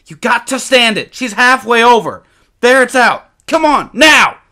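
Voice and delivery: put-on high, loud, urgent boy's cry; panicked shout. A narrator's reading. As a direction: yell it with a bear coming. Boy urges brother from bear.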